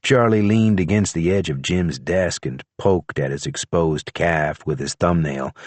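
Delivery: ordinary delivery, nothing marked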